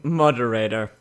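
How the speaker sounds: ordinary delivery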